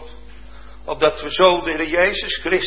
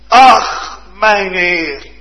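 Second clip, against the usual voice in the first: loud, higher cry of Ach, stress on mijn; anguished cry; 6 semitones higher, 10 dB louder, much slower than usual. Acted. Desperate man crying to the man of God, loud. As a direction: cry out to the Lord in need.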